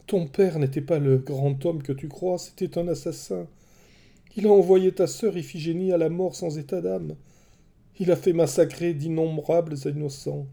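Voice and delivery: high, urgent begging voice; pleading. A narrator's reading. Queen begging her son with a sword, high and urgent. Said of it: "La reine le supplia".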